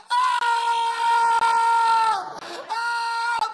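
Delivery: high, loud terrified screaming; mock scream. Couple seeing faces in the window, loud mock scream. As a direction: scream like you've seen ghosts.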